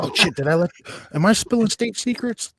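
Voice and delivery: high, loud fake panic at a slip; mock alarm. Joking reptilian overlord caught spilling secrets, mock alarm. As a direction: play caught out, pitch jumping up.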